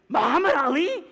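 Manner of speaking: high, loud incredulous question; shocked. Retold or imitated. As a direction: blurt the name out in disbelief. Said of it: "shocked when he was like".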